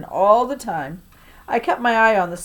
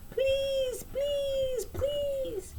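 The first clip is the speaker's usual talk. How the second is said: very high, soft puppy whine on each please; whining like a dog; 10 dB quieter, much slower than usual. A narrator's reading. Boy begging grandma for candy, high puppy whine. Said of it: "begin to whine like a dog".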